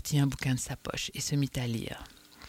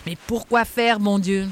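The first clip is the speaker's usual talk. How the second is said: louder, higher exasperated cry; exasperated shout; 5 semitones higher, 6 dB louder than usual. Acted. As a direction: throw up your hands in disbelief. Danglars exasperated, still shouting. (exaspération criée, plus fort et plus aigu)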